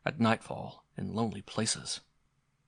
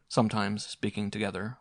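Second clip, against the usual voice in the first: plain reading voice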